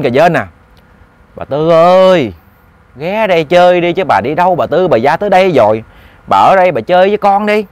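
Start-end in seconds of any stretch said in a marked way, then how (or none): 1.4-7.7 wheedling, nagging ghost. Acted. Ghost begging Bà Tư to stay and play, wheedling. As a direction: coax her to come and play, lightly voiced ghost. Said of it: "nó nói cái giọng cà dên".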